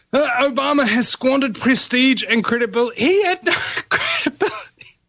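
laughing in disbelief. Real, not acted. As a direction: crack up laughing mid-sentence. Mocking Obama's credibility, laughing.

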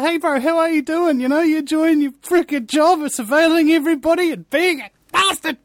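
high, mocking bro voice; mock friendly bro. Host mocking a spy with a fake-friendly bro voice. Mocking greeting to surveillance agent.

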